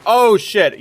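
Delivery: sudden loud high curse; startled. Real, not acted. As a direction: blurt it out startled. Sudden scare in game.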